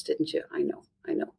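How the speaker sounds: very soft, hushed reassurance; low-key soothing. Shown as a demonstration. Parent validating a child's upset over crisps, soft and soothing. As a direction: trail down to a gentle near-whisper. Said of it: "keeping your voice like a sloth".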